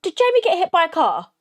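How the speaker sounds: puzzled, pitch up, half laughing at herself; real confusion. Real, not acted. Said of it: "I'm really confused".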